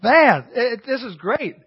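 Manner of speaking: amazed. Retold or imitated. Recalling his own delight at applied truth, amazed and loud. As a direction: marvel at it out loud. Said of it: "You know".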